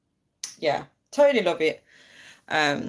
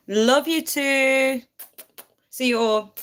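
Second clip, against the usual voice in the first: high, loud, drawn-out sign-off; calling out goodbye; 8 semitones higher, 5 dB louder, slower than usual. Real, not acted. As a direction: sing out a farewell to the viewers. Calling goodbye to live viewers.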